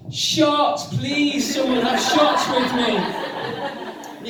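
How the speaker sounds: high, loud, slow begging for shots with a laugh; desperate plea. Retold or imitated. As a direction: plead like your night depends on it. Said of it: "a desperate plea".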